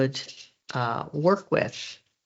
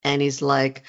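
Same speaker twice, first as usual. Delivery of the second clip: ordinary talk, nothing marked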